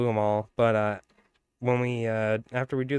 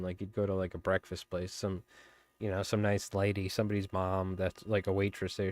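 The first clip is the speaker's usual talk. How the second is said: ordinary delivery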